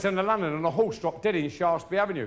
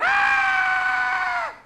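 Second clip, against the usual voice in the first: put-on high shrieking baby wail; piercing newborn yelp; baby's cry; 8 dB louder than usual. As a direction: let out a sudden high baby scream. Baby slapped, cries out.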